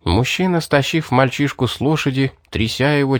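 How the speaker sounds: usual speaking voice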